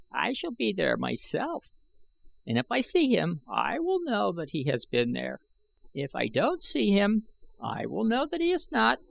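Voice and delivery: put-on high, thin voice of a scheming old man; sly old man. A narrator's reading. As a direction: let the old man slip in the treason. Old taxidermist explains.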